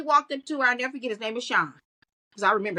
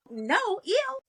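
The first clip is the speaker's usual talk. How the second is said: higher, mocking brush-off, with a disgusted "ew"; mock disgusted woman; 10 semitones higher than usual. A woman mocking how women turn down unwanted men. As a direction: toss it off like a woman shooing away a pest. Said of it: "So when we go".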